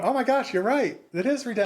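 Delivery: high, bright-eyed "oh my gosh" of sudden realizing; retold realization. Telling cohost how her point about redemption clicked, surprised. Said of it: "and I'm going".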